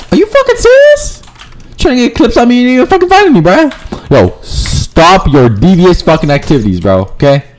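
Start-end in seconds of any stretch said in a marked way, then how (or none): 0.1-3.7 high-pitched, incredulous complaint; exasperated outburst. Real, not acted. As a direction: protest in a high, indignant voice. Outraged at players targeting them.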